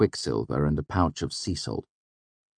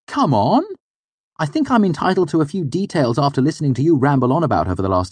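fast, much higher and louder pushy demand; eager pleading; 11 semitones higher, 6 dB louder, faster than usual. Acted. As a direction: wheedle for the juicy details. Sim pressing for details.

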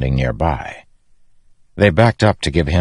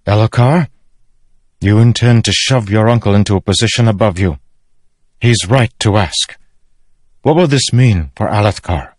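higher, louder challenging woman; raised, commanding royal mother; stern mother; 6 semitones higher, 5 dB louder than usual. Acted. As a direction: press your son for an answer. Narrator voices Navani.

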